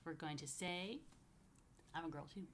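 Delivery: normal talking voice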